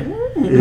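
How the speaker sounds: high pondering mm; mock thoughtful hum. Friend retells a comedian's bit with a mock thoughtful high hum. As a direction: hum high like you're taking notes. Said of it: "and he's like, making these faces".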